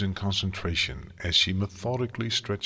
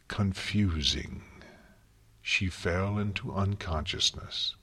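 usual speaking voice

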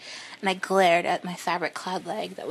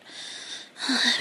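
breathy high sigh; 4 semitones higher than usual. Acted. Erotic scene, breathy sigh.

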